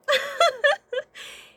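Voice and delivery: loud, high squealing laugh; real delighted squeal of laughter; high burst of laughter. Real, not acted. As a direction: crack up in a shriek. Laughing at old man story.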